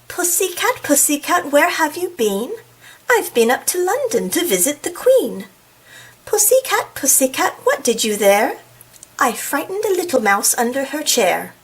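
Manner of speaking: lilting, hammed-up British accent; bad British accent. Reader acts out a cat rhyme for viewers, terrible British accent. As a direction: put on an amateurish British accent. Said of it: "That was a terrible British accent".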